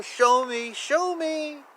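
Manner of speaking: usual speaking voice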